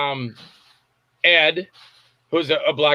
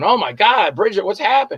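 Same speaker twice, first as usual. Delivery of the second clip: retold shocked reaction, pitched up; higher, mock-startled questioning; alarmed concern; 5 semitones higher than usual. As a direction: replay your alarm at bad news. Retelling his alarm at crash news.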